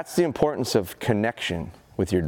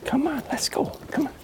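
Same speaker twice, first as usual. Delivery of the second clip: much higher, bright come on to the dog; high coaxing call; 14 semitones higher, 3 dB quieter than usual. Real, not acted. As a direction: call him in with a sing-song pitch.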